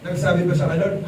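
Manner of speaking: ordinary talk, nothing marked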